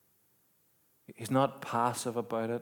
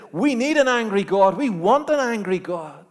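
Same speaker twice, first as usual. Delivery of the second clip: louder, higher, building sermon climax; preaching at full force; 10 semitones higher, 9 dB louder than usual. Acted. Preacher rousing the congregation about God's anger, full force. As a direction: drive it home to the congregation.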